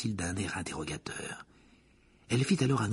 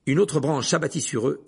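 ordinary delivery, nothing marked

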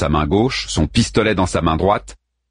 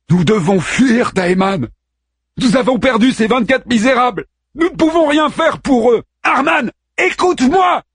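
urgent shouting; 3 dB louder than usual. A narrator's reading. Daeman urging Harman to flee.

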